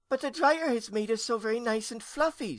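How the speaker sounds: put-on very high little-girl voice; young ghost girl. A narrator's reading. Niece answering her scolding aunt, high and childlike. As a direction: pipe up high in protest.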